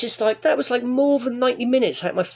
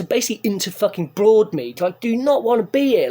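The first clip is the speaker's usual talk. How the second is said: ordinary delivery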